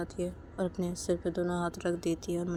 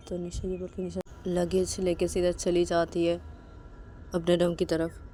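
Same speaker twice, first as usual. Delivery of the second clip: ordinary delivery, nothing marked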